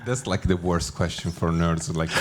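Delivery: ordinary talk, nothing marked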